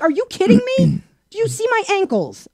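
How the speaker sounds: high, incredulous outburst; outraged disbelief. Retold or imitated. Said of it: "I'm like".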